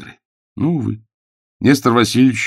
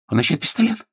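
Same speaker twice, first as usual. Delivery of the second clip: frightened babble; 11 semitones higher than usual. Acted. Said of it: "пролепетал Ковальский".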